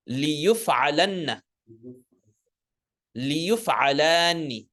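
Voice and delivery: measured recitation with long, held vowels; say-after-me drill. Shown as a demonstration. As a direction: model each form for repeating. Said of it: "say it after me".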